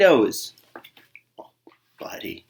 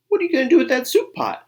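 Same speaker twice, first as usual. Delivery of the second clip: high fast eager little voice; curious bunny; 6 dB louder, faster than usual. Acted. Buddy asks the varmint about his soup pot, high and quick.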